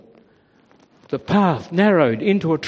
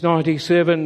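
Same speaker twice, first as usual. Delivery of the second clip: ordinary delivery, nothing marked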